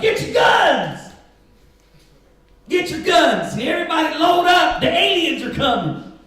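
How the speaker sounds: high, loud mock rallying shout; panicked call to arms, hammed up; shouted alarm. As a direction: yell it like calling everyone to arms.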